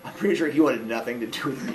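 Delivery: ordinary talk, nothing marked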